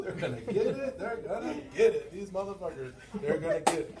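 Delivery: laughing, psyched-up chant to himself; hyped-up self talk. Retold or imitated. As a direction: pump yourself up before the door. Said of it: "pumping myself up".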